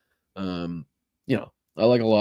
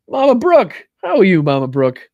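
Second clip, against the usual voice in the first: excited greeting; 8 semitones higher, 9 dB louder than usual. Real, not acted.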